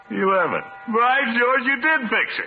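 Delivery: high, loud, overjoyed burst; delighted. Acted. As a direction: crow with joy as the clock runs again. Clock runs again, he rejoices.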